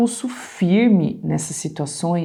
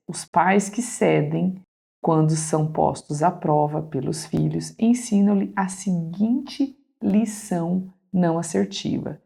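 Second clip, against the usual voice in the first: ordinary talk, nothing marked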